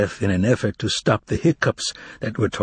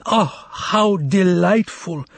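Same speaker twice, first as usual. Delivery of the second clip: higher, slow, delighted foreign exclamation; amazed cry; 7 semitones higher, much slower than usual. Acted. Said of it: "cried the amazed foreigner".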